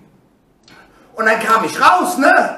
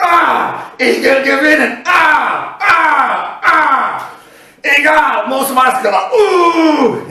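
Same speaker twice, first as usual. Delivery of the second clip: loud roars between determined words; re-enacted psyching-up shouts; ambitious battle cries; 3 semitones higher, 4 dB louder, much slower than usual. Athlete pumping up to win, roaring with determination. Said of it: "ich war so voll Ehrgeiz".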